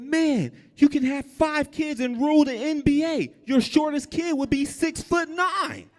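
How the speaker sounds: wide-eyed high-pitched gushing; awed high voice. Retold or imitated. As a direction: gush at the tall man in a high voice. Teller mocks own awed high voice.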